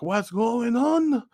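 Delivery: high, long drawn-out question; retold bystander. Man outside asking the kicked-out customer, high and drawn out. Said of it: "the little Mexican guy".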